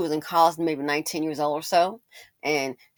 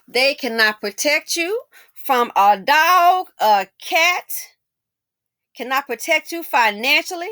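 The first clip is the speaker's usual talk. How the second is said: higher, louder sermon-like lecture to the ladies; preachy rant; 6 semitones higher, 5 dB louder than usual. Real, not acted. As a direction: preach it like a warning from the pulpit.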